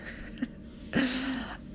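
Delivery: quiet embarrassed giggle; soft laugh. Real, not acted. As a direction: giggle softly. Laugh at own remark.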